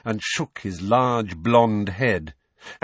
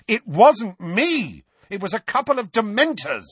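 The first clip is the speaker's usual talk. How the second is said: angry; 14 semitones higher, 5 dB louder than usual. A narrator's reading. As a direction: insist with rising temper. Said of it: "temper and voice both rising".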